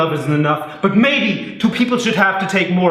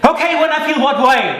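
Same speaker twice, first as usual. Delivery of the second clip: exasperated question; 11 semitones higher, 3 dB louder than usual. Acted. Husband exasperated in fight.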